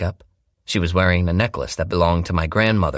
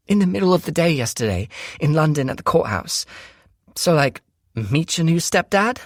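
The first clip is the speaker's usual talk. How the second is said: put-on higher, rushed, frightened girl's voice; frightened sister; 11 semitones higher than usual. A narrator's reading. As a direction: blurt it all out in panic. Shelby continues, fast.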